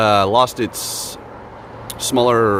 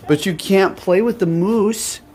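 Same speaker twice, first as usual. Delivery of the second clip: cooing at the dog; 6 semitones higher, slower than usual. Real, not acted. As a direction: praise the guard doggy sweetly. Coos at the guard dog.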